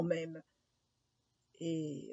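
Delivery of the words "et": plain reading voice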